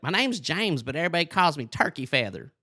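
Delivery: high, put-on boyish cartoon voice; Turkey Feather. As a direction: imitate an inmate who sounds like a cartoon boy. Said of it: "looking and sounding like Bobby Hill".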